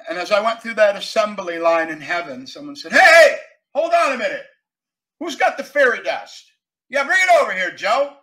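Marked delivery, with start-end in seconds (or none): 2.9-8.2 loud, high-pitched foreman's shout; calling out. Acted. Worker calling down heaven's assembly line, loud shout.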